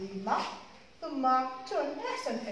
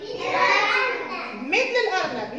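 higher, louder rabbit call; excited answer; 6 semitones higher, 5 dB louder than usual. Shown as a demonstration. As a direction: cheer it like a game answer.

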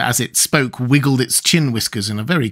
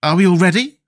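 put-on higher, louder boy; Tip's boy voice; 8 semitones higher, 5 dB louder than usual. A narrator's reading. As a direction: call out in a raised boy's voice. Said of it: "asked the boy".

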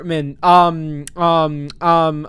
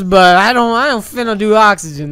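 ordinary talk, nothing marked

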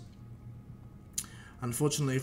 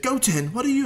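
loud, higher-pitched startled call; acted fatherly alarm, raised voice; startled dad; 12 semitones higher, 9 dB louder than usual. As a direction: call out to your kid in disbelief. Father startled to see Goten land.

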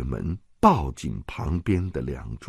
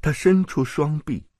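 ordinary delivery, nothing marked

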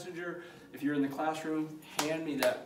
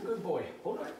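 ordinary talk, nothing marked

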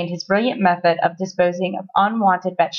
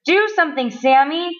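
high, urgent mom voice; crying out; 6 semitones higher than usual. A narrator's reading. Mom calling on Sammy for rescue, crying out. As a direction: urge him with a mild cry. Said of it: "cried Sammy's mom".

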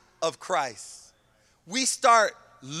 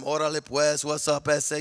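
lower-pitched imitation of a Chicano gangster; gangster slang voice; 5 semitones lower, 3 dB quieter than usual. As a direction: lightly put on a gangster's greeting. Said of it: "gangsters and you start talking like them".

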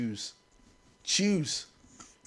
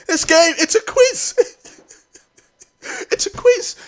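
half-laughing, half-whining in despair; mock crying laughter; 10 semitones higher than usual. Real, not acted. Gamer laugh-crying at the quiz, a touch of mock tears. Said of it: "I'm gonna start crying".

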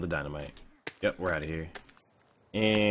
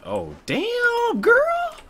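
shocked squeal; 11 dB louder, much slower than usual. Real, not acted. Reacting to enemy woman arriving.

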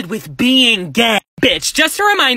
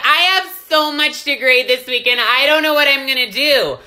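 mocking a whiny teacher. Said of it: "They would be like".

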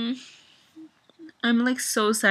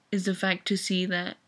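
usual speaking voice

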